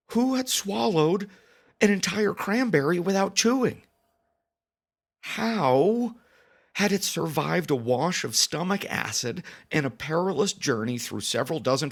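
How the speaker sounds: horrified disbelief. Acted. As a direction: rise into appalled, incredulous outrage. Horrified rhetorical questions about cranberry.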